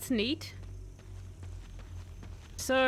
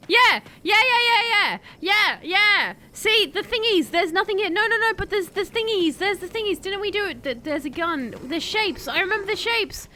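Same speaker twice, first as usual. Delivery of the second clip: high, loud burst of triumph; loud, high cheering excitement; excited shouting; 7 semitones higher, 6 dB louder than usual. Real, not acted. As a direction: yell it out, thrilled at the discovery. Excited at puzzle discovery in game.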